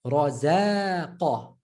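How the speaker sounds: Razaqa slowly, with undoubled Z; single Z. Shown as a demonstration. Teacher reading it before adding shadda, single Z. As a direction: draw out Razaqa without doubling Z. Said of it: "Add the shadda".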